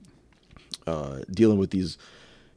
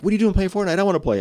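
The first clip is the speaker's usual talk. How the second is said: son's voice; 9 semitones higher, faster than usual. Retold or imitated. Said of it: "He's like".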